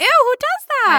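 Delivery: high, loud disgusted "ew"; grossed-out squeal. Real, not acted. A co-host squeals in disgust at pinned butterflies. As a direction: recoil in disgust.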